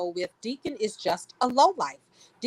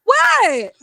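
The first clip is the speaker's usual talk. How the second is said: high, loud stunned what; squealed disbelief; shocked; 12 dB louder than usual. Real, not acted. Friends teasing about remark.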